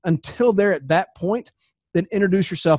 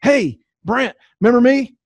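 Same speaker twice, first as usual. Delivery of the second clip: overeager greeting; 6 semitones higher, 7 dB louder than usual. Retold or imitated. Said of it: "if you say".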